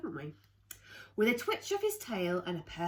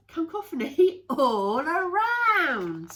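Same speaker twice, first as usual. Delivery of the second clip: laughing; 9 semitones higher, 8 dB louder, slower than usual. Real, not acted. Reading to kids, laughing after tripping on the word. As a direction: laugh through the word, slow and loud.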